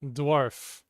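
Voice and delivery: "Dwarf" said slowly and clearly, d-w onset, long or, crisp final f; British dwarf. Shown as a demonstration. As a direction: model "Dwarf" carefully for a learner, one syllable. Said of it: "I shall try again".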